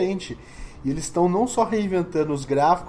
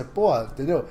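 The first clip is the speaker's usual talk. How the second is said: ordinary delivery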